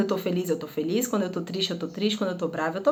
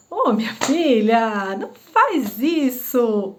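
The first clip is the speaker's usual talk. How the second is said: slow, gentle, too-sweet no; soft sweet scolding; 3 dB louder, much slower than usual. Shown as a demonstration. Mother cooing at baby, the wrong way: slow soft no. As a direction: coo the warning softly like it doesn't matter. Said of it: "eu não posso falar não para um bebê assim".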